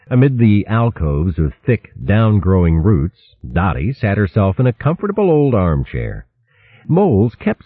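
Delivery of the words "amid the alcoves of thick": usual speaking voice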